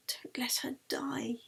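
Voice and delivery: soft, breathless whisper of a prayer. A narrator's reading. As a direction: pray under your breath. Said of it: "Yeli prayed".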